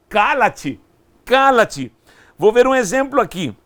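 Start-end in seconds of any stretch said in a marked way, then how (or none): 0.1-1.9 loud, firm order to be silent; authoritative command. Retold or imitated. Preacher voices Jesus silencing the demons, authoritative command. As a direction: command it with full authority, louder on the repeat. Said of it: "Jesus com autoridade mandou os demônios se calar".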